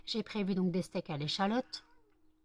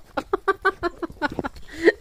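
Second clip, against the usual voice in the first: laughing high and loud; 10 semitones higher, 7 dB louder than usual. Real, not acted. Owner buried in dog kisses, high laugh. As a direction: laugh helplessly.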